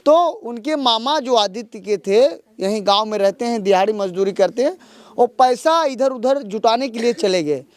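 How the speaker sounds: earnest field-reporter summing up for camera; news report. Acted. Reporter wraps up the story for viewers, earnest news delivery. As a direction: report it like a TV correspondent on location.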